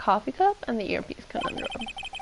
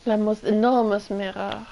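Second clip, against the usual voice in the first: put-on terrible French accent. Streamer voicing the French chef to the defense attorney, bad French accent. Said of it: "terrible French accent".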